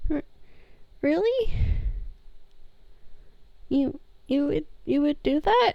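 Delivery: high, soft and halting; timid disbelief. Acted. Sammy answers the shopkeeper's kind offer, timid and amazed. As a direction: ask softly, as if hardly daring to believe it.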